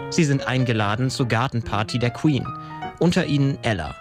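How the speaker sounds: ordinary talk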